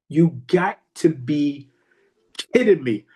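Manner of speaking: slow, spaced-out words with pauses; emphatic disbelief. Acted. Man realising his mistake, slow emphatic disbelief. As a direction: stretch out YOU GOT TO BE, then KIDDING ME.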